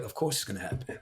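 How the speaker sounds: gently reassure, voice dropped low; quiet reassurance. Retold or imitated. Wife talking him back off the ledge, quiet reassurance.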